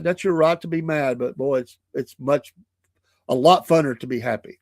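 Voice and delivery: ordinary talk, nothing marked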